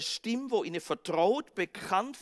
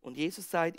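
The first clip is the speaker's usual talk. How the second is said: ordinary talk, nothing marked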